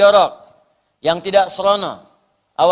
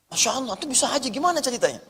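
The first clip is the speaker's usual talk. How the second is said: quick, delighted surprise; amazed exclamation; 5 semitones higher, 6 dB quieter, faster than usual. Retold or imitated. Ustadz marvels to the man, fast and surprised. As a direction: react as if stunned by good news.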